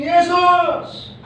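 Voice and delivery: high, drawn-out cry; acted persistent holler; mock scream. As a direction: scream the name without extra volume, pitched right up. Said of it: "mereka terus screaming".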